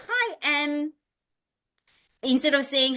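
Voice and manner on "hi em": put-on high child voice; high, childlike parroted hello; little boy. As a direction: imitate a small boy echoing a greeting. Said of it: "he will go".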